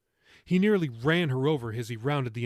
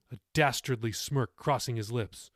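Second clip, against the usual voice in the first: usual speaking voice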